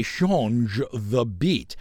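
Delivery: "change" said as French /ʃɑ̃ʒ/; French pronunciation. Shown as a demonstration. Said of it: "but it's French".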